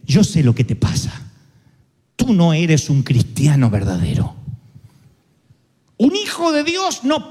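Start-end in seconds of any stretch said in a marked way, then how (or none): 0.1-4.3 low, heavier accusing voice; sinister accuser. Acted. Voice at the mirror accusing the believer, low and menacing. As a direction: lean in low like a voice of condemnation.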